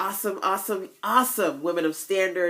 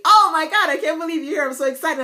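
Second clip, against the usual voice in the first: much higher and louder, gushing; excited fan; 11 semitones higher, 8 dB louder, faster than usual. Retold or imitated. Young lady greeting the speaker, gushing excitedly. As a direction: squeal with delight. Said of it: "she said to me".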